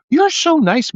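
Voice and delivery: high, gushing nurse voice; mock sweet nurse. Nurses praising him, retold.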